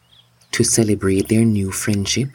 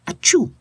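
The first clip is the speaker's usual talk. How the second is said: sneeze. Acted.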